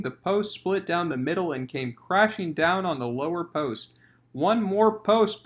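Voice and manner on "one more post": slow muttered line; slow private resolve; to himself. Acted. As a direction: say it to yourself slowly. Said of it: "said to himself audibly".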